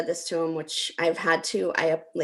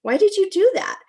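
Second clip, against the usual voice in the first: high, louder indignant demand; angry demand; 12 semitones higher, 6 dB louder than usual. Retold or imitated. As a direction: confront him, outraged. Confronting brother at reunion.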